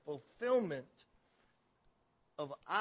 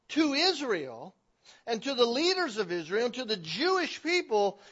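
louder, raised preaching voice; forceful preaching; 8 semitones higher, 12 dB louder than usual. Real, not acted. Preacher declaring their blindness to the congregation, forceful preaching. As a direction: drive the point home to the congregation.

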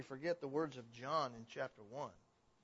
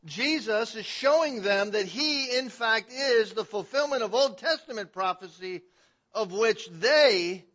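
loud, higher-pitched preacher; forceful preaching; 7 semitones higher, 14 dB louder than usual. Real, not acted. Preacher showing Christ fulfils prophecy, forceful preaching. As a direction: proclaim it, leaning hard on the key words.

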